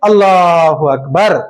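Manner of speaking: prayer takbir. Shown as a demonstration. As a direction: intone it like the imam. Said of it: "फिर हम रुकू में जाएंगे".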